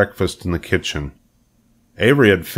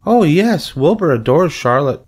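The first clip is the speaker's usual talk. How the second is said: put-on high, louder gushing child; Fern; 9 semitones higher, 5 dB louder than usual. A narrator's reading. Fern assures her mother, high enthusiastic child. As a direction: burst out eagerly in a little girl's voice. Girl gushes to parents.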